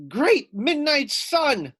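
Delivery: high, loud, slow howl. Acted. Foxy Loxy howling after being hit by ice. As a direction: howl out in shock. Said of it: "howled Foxy Loxy".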